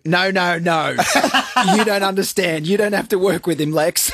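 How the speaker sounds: laughing. Retold or imitated. Reading comment, laughing through.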